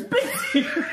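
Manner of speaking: high laughing mimic. Mocking his comfort to his face, high and laughing. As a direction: squeak it out, cracking up. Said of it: "with this toilet paper going".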